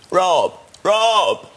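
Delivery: calling his name up high from the landing; high-pitched call after someone. Retold or imitated. Jagger calling him from landing.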